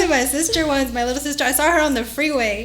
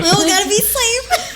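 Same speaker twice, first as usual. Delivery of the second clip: higher, laughing mock-careful voice; mock cautious driver; 8 semitones higher than usual. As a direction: tease in a high goody-goody voice. Mimics cautious drivers, jokingly.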